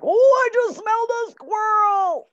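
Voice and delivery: high, loud baying hound voice; dog bursting with news; excited hound dog. Acted. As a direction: howl the words like an excited hound. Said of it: "hound dogs that will bark".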